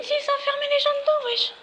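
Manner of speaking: high, excited disbelief. Real, not acted. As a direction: voice jumps high in amazement. Imagining prisoners locked inside. (excité)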